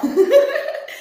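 a high, loud burst of laughter. Real, not acted. Telling friends about weed comments, high laugh. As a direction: squeal with laughter.

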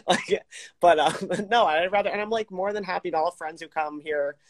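usual speaking voice

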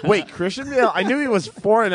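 high-pitched, puzzled surprise; mock bafflement. Viewer reacting to the actor's real accent, in mock bafflement. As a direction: act the confused viewer, voice jumping up.

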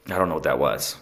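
ordinary delivery

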